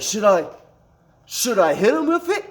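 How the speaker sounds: high-pitched, rising mock question; squeaky-high retold plea for a go-ahead; retold eager question. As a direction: imitate a buddy asking, voice shooting up. Retold buddy eagerly asks permission.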